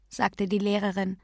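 ordinary delivery

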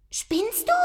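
very high indignant cat voice; squeaky cat complaint; complaining cat. A narrator's reading. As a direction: protest as an affronted cat. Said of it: "beschwerte sich Moppel".

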